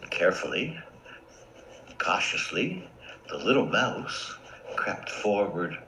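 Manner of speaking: hushed, low storytelling; suspenseful near-whisper; cautious narration. A narrator's reading. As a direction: tiptoe through the words softly, with pauses. Narrator mimics cautious creeping.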